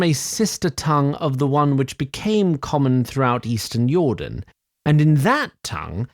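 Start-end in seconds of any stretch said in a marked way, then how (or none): none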